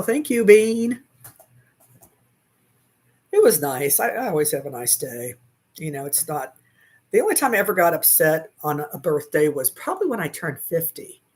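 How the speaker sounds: ordinary talk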